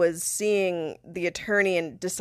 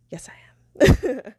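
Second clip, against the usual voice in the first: slow, emphatic conspiratorial whisper; 5 semitones higher, 5 dB louder, much slower than usual. Retold or imitated. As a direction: whisper it back with relish. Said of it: "whispered it".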